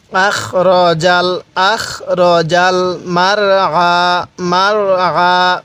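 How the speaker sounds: মারআ with a full heavy র, very slow. Shown as a demonstration. Teacher demonstrating heavy ra in মারআ, slow. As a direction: recite মারআ, the র thick and full. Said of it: "রটা পুর করে পড়ব".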